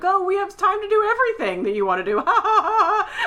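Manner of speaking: high, fast, laughing manic chatter; manic girlfriend. Retold or imitated. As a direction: gush it out brightly with a giddy laugh. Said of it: "like, manic".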